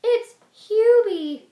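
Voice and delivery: very high, slow and loud; mama bear announcing. Acted. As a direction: call out the name like a thrilled bear. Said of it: "says the mama bear".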